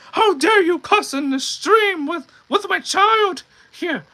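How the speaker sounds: high, loud outraged parent voice; angry parent. Pretend. Streamer imagining an angry parent coming into the stream, angry. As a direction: put on a shrill-high scolding parent, drawn out. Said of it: "you've had an angry parent".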